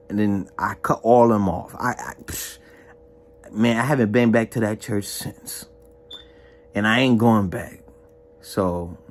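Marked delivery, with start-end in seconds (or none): none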